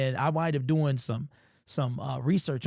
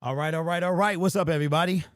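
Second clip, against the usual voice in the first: excited greeting; 5 semitones higher, 4 dB louder, faster than usual. Real, not acted. Energetic stream opening greeting.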